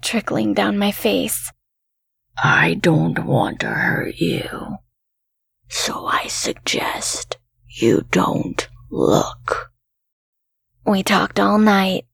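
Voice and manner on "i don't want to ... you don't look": slow, low voiceless whisper; low creature whisper. A narrator's reading. Creature under the bed warns her off, low whisper.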